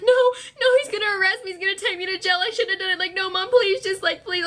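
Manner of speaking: high, frantic begging; panicked pleading. Retold or imitated. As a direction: beg mom in a panic, voice up high. Pleading with mom, crying.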